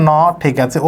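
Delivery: ordinary talk, nothing marked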